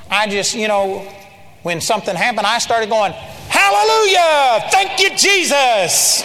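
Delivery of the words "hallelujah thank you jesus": high, drawn-out hallelujah shout; joking praise shout. Retold or imitated. Preacher startling the guys on the basketball court, joking praise shout. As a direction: belt out praise, long and loud. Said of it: "I was kind of joking around with them".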